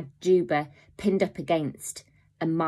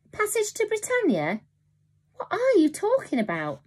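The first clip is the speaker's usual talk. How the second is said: bewildered. A narrator's reading. Boy bewildered.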